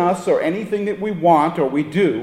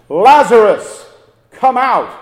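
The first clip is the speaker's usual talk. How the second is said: commanding shout, much higher and louder; loud call; 7 semitones higher, 9 dB louder than usual. Retold or imitated. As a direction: call him out of the tomb with full force. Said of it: "Jesus called out in a loud voice".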